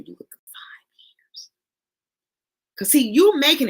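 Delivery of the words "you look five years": whisper. Retold or imitated. Susan's words, told in awe.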